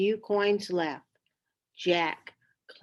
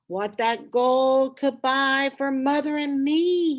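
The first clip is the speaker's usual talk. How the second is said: wistful wonder; 6 semitones higher, 5 dB louder than usual. A narrator's reading. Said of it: "he thought".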